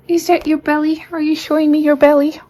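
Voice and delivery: high cooing pet voice; high pet talk. Real, not acted. Cooing at cat's belly.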